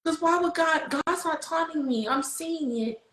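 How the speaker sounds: very high, thin-voiced self-reassurance; overcome insistence. Convincing herself God answered, high overcome voice. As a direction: squeak it out, slowing on the last words.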